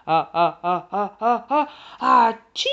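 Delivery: loud building ah-ah-ah into a huge achoo; explosive acted sneeze, climbing to the burst; giant's sneeze. A narrator's reading. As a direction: wind up and let out a mighty sneeze. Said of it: "estornudó el gigante".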